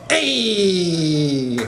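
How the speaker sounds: long, falling Italian American exclamation; Italian American impression. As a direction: stretch one syllable like a caricatured Italian American. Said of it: "impression of an Italian American saying".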